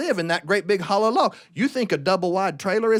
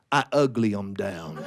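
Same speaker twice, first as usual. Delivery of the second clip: low, slow deadpan; lightly voiced old hunter; 12 semitones lower than usual. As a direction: drop it low and slow, matter-of-fact. The hunter explains his trick.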